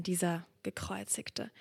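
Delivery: hushed, breathy question; whisper. Acted. Preacher asks about the crucified one, whispered. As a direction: let it fall to a whisper.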